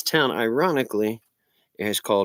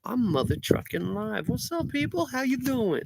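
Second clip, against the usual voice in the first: excited; 13 semitones higher, 4 dB quieter than usual. Real, not acted. Excited livestream greeting.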